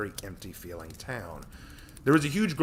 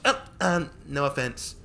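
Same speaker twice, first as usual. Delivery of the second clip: high, nervous apology; flustered squeak; 6 semitones higher, 6 dB louder, slower than usual. Acted. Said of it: "Tori quickly squeaked".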